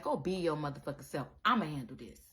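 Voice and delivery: ordinary talk, nothing marked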